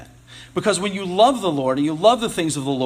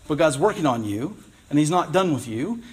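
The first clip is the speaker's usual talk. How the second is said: usual speaking voice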